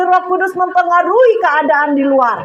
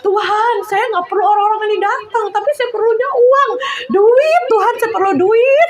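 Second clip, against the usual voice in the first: retold crying out; 8 semitones higher than usual. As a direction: cry out to God for money, much higher. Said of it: "Saya bilang gitu".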